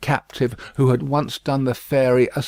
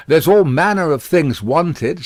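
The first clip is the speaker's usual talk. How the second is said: raised, bustling spinster voice; busy housekeeper in a hurry; brisk Miss Pross; 6 semitones higher, 7 dB louder than usual. A narrator's reading.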